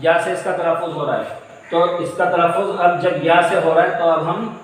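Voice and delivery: ordinary talk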